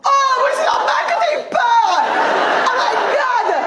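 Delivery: high, slow, laughing overdone pity; mock exaggerated sympathy. Comedian mocking an audience mother's fear, exaggerated pity. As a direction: lay the fake sympathy on thick, laughing.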